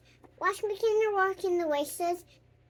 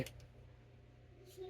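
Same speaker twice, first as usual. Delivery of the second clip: soft playful whisper; whispering while hiding; 21 dB quieter, much slower than usual. Real, not acted. Child hiding, playing peekaboo.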